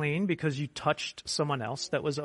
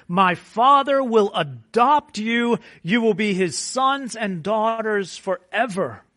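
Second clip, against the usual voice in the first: higher, louder promise building up; full-force preaching; 6 semitones higher, 8 dB louder than usual. Acted. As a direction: proclaim it with rising fervour.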